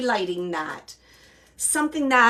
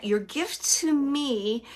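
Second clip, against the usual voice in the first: "Your gift to me" drawn out slowly; spoken slowly and earnestly; slow, deliberate quote; 3 semitones higher, slower than usual. Shown as a demonstration. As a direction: let each word of "Your gift to me" land, slowly. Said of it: "and he's saying it like that".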